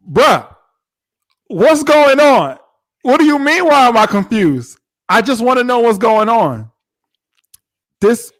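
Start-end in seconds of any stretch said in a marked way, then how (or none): 0.1-6.7 loud, raised-pitch mock outrage; exasperated demand. Bride confronting husband who came back late, exasperated. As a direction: act out a bride demanding answers.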